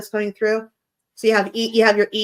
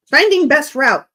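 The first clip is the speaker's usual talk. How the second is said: a mock sat-nav readout, raised and louder; phone voice; 4 semitones higher, 5 dB louder than usual. Reading out his phone's message, mock sat-nav voice. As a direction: read it out like a navigation app. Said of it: "My phone is trying to say".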